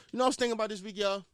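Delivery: ordinary delivery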